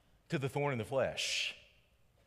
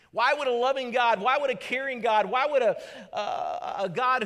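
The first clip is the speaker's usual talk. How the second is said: loud, higher repeated why; fiery preaching; 8 semitones higher, 7 dB louder than usual. Real, not acted. Pastor asks why God allows burdens, loud and high. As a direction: hammer each why louder. Building rhetorical cry.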